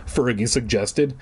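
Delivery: usual speaking voice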